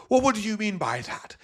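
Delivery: high-pitched put-on indignation; mock umbrage. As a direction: imitate an affronted interviewer. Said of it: "all this umbrage".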